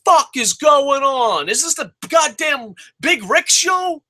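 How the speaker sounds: slow, high, shouted disbelief; retold outburst. Fan reliving shouting at the TV, slow and high. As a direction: yell it at the TV again. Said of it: "I was just like".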